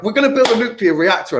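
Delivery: hammed-up proud declaration; mock government boast. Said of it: "where we're like".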